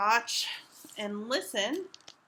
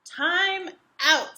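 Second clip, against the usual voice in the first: louder call; calling time out; 4 semitones higher, 6 dB louder than usual. Acted. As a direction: call a halt. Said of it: "says Pug".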